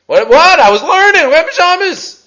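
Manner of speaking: high, loud protest in a retold dorm scene; indignant roommate. Late-night learner excusing himself to sleeping roommates, indignant. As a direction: protest with rising pitch, acting it out.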